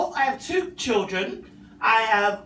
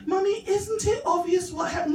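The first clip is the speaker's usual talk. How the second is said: put-on higher smug child voice; little boy voice; 8 semitones higher than usual. Son lecturing his mum that it's obvious, high and smug. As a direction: act like a know-it-all toddler.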